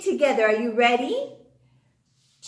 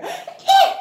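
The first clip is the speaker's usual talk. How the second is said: mock surprise; 7 dB louder than usual.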